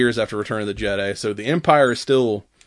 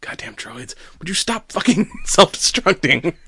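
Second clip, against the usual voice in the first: laughing mock exasperation; 6 semitones higher than usual. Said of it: "Him like".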